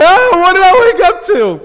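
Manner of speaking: high, loud, laughing panic; half-laughing mock fright; scared. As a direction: cry out in startled disbelief. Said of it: "I was like".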